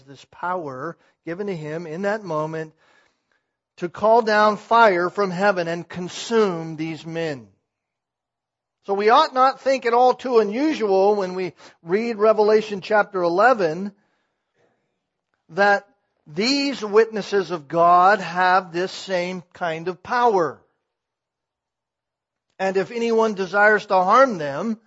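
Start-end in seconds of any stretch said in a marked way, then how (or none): 3.8-20.6 loud, rising fervent sermon voice; a preacher building to a loud climax; full-force preaching. Acted. 22.6-24.8 loud, raised verse reading; preached verse. Acted. Verse read while preaching.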